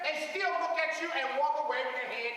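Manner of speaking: high, loud, shouting sermon; full-force preaching. Acted. Preacher warning church about doubters, shouting. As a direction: call it out to the whole house.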